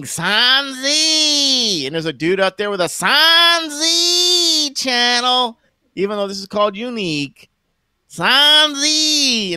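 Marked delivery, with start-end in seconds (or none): 0.0-1.9 high mock yell of the name; mocking Kyle's call. Mocking Kyle's catchphrase to his fans, high yell. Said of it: "He was saying". 8.1-9.6 high mock yell of the name; mocking Kyle's call. Mocking Kyle's catchphrase to his fans, high yell. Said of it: "He was saying".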